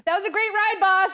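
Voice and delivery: high, sassy gossiping voice; sassy reindeer. Acted. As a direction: camp it up as a catty reindeer. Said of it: "Two reindeer quietly trash-talking Santa".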